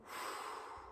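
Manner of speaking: quiet breathy sigh of release; soft sighed exhale. Shown as a demonstration. Teacher showing how to release held breath, soft sigh. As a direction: let all the air go softly.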